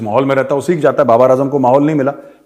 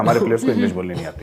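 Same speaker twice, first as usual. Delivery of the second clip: quick, softer lower throwaway mimicry; mocking Shoaib quote; 5 semitones lower, 4 dB quieter, faster than usual. Host mocking Shoaib's complaint about English, quick and softer. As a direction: toss it off as a mock of his complaint. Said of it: "वो कह रहे हैं".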